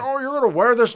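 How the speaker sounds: mock voice of Rudolph's dad. Imitating Rudolph's dad sneering at his son's nose. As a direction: sneer it like a mean reindeer dad. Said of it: "how I'm doing these voices".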